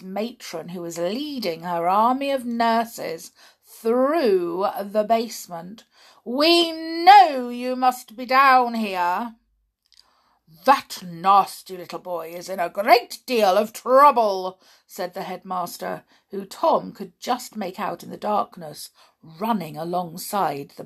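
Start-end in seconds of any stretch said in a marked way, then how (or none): 6.2-14.6 put-on loom over the hiding boy; stern menacing call; menacing adults. Matron and headmaster hunting boy.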